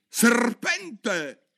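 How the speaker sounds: high, loud shriek of "Serpente!"; the pigeon screeches "Serpente!" in alarm; shrill shriek. Acted. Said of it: "strillò il piccione". (il piccione strilla "Serpente!" acuto e forte)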